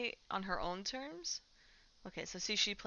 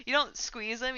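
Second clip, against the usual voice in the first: ordinary delivery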